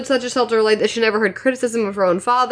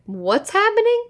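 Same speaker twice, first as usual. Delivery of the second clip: confused surprise; 9 semitones higher than usual. Retold or imitated. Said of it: "but I was like".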